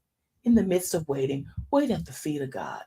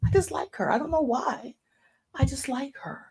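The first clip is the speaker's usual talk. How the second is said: higher-pitched eager quote; higher, lively quote; 7 semitones higher than usual. Retold or imitated. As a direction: tell God brightly, higher up. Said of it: "I told God, same thing".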